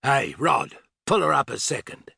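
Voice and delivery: put-on brusque Australian-accented command; snapped Australian order. A narrator's reading. Older man to young man at the controls, snapping an Australian-accented order. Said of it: "He snapped an order".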